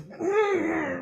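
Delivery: high strangled moan behind a hand; stifle the cry; muffled pained whimper. Acted. Alexander clamping his mouth shut, muffled whimper.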